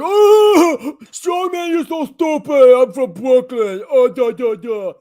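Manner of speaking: high, loud taunting caricature; mocking Brooklyn guy. Vlogger imitating a rival YouTuber who mocked him, high loud taunt. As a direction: sneer it like a cocky Brooklyn YouTuber. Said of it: "And he was like".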